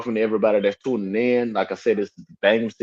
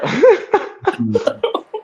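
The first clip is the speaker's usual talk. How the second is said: high laugh. Real, not acted. Laughing at own voice.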